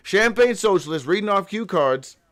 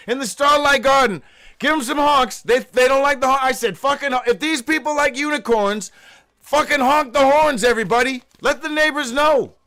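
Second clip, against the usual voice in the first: higher, louder rally cry; hyped call-out; 7 semitones higher, 3 dB louder than usual. Real, not acted. Host urging listeners to honk for unicorns, hyped and loud. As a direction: rouse the crowd to honk.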